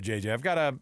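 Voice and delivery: usual speaking voice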